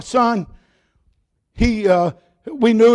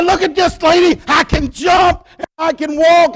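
joyful shout; 6 semitones higher than usual. Retold or imitated. Healed lame man showing the lady he can walk, joyful shout. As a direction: shout it like you just got your legs back.

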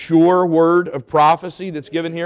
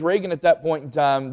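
ordinary delivery

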